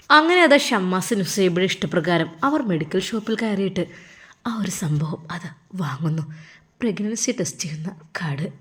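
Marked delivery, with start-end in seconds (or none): 4.5-8.5 whispered aside about the test; secretive whisper. A narrator's reading. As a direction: hush it like a secret. Secret pregnancy test purchase. (രഹസ്യമായി മന്ത്രിക്കുന്നു)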